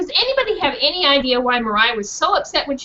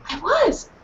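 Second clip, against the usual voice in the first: proud dog; 9 semitones higher, 5 dB louder than usual. Acted. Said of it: "said Mariah".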